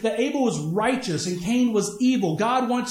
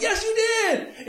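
high, drawn-out celebratory cry; elated cheering; 12 semitones higher, much slower than usual. Retold or imitated. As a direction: cheer a kid on at full excitement. Coach cheering the scoring kid.

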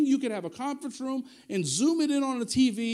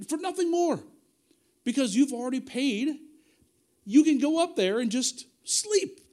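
ordinary talk

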